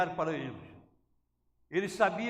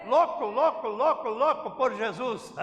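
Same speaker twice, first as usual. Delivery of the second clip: shout; 3 semitones higher, 10 dB louder than usual. Real, not acted. Pregador exalta a plateia.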